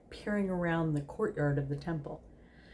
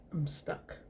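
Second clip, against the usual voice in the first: whispered snake voice; 7 dB quieter than usual. Acted. Snake Crowley admits trouble.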